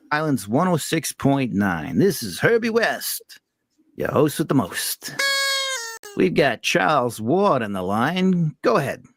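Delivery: put-on a showman DJ in a Rhode Island accent. Host introduces a caller on air in a Rhode Island accent. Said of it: "tried my best Rhode Island accent".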